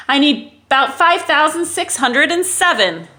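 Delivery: loud, stubborn royal vow; defiant king. Acted. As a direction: refuse to be outdone by the moon. King defying the moon.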